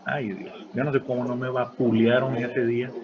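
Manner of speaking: ordinary talk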